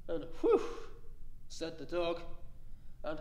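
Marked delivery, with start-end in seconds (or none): 0.4-0.9 a high sigh of relief from the dog; relieved whew. Acted. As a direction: let the fear go out in one high breath. Dog relieved after danger passes.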